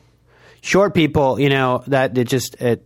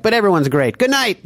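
Rickles closing his act; 9 semitones higher than usual. Retold or imitated. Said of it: "when Rickles at the end of his act".

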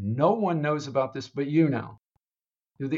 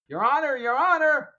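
high, raised call to the judge; 12 semitones higher, 3 dB louder than usual. Retold or imitated. Lawyer interrupting the judge, high raised call. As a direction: call out to get the judge's attention.

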